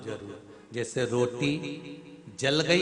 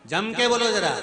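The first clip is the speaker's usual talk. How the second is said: higher, louder rousing call; calling out to crowd; 7 semitones higher, 7 dB louder than usual. Real, not acted. Preacher urging the crowd to answer, loud rousing call. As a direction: push the audience to shout back.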